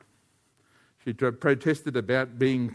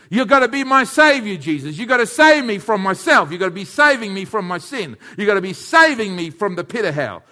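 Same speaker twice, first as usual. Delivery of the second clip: loud, high, fervent preaching; full-force preaching; 8 semitones higher, 12 dB louder than usual. Acted. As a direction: cry out to Jesus from the pulpit.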